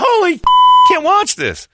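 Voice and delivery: high, loud startled outburst; shocked exclamation. Retold or imitated. Host reacting to violent crash clips, high startled outburst. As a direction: blurt it out in shock.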